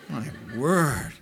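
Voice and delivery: ordinary talk, nothing marked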